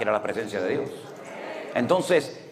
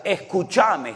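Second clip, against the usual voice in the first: "Escúchame" in an Argentine style, with a sharp high start; 7 semitones higher, 3 dB louder, much slower than usual. Retold or imitated. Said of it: "nosotros los españoles no decimos escúchame".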